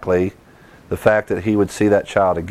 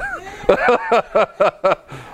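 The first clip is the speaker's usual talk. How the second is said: real laughter rising high then falling; high burst of laughter; 10 semitones higher than usual. Real, not acted.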